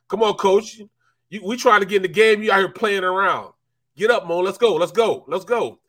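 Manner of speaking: loud, high, urging him to get up; hyped-up yelling at a downed player; mock teammate yelling. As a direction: shout at a teammate on the ground.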